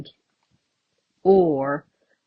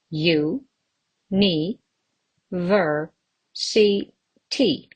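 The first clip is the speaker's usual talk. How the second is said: teacher sounding out syllables; slower than usual. Shown as a demonstration. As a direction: separate syllables with pauses between. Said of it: "Again, five syllables".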